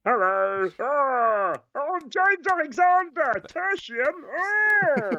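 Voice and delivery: very high, slow silly squeal; mock your co-host in falsetto; hammed-up squeaky James Alexander impression. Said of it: "Can you do your best James Alexander voice".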